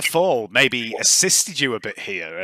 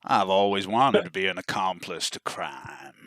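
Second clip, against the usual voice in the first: slow, lower, softer character voice; accomplice character; 4 semitones lower, 4 dB quieter, slower than usual. Acted. Henchman agreeing to cover a thief, slow and low. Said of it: "He says".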